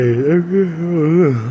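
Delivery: loud, high burst of laughter; loud laughing. Real, not acted. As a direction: crack up loudly. Laughs after the crash.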